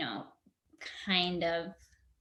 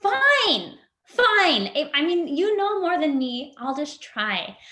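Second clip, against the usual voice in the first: much higher, louder exasperated fine, fine; grudging surrender; 6 semitones higher, 6 dB louder than usual. Retold or imitated. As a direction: give in with a huffy, high-pitched sigh of defeat. Retold grudging surrender to therapist.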